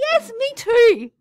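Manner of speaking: loud, high burst of excitement; delighted agreement. Real, not acted. Teacher sharing the class's favourite function, excited and loud. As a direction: agree with a big excited leap in pitch.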